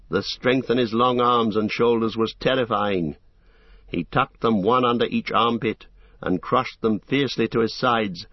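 usual speaking voice

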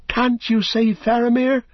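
very high, anxious plea; high-pitched worried appeal; Pippin pleading. A narrator's reading. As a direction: beg desperately in a high hobbit voice. Pippin begs Gandalf to save Faramir.

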